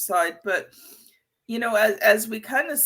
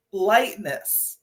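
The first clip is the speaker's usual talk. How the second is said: ordinary delivery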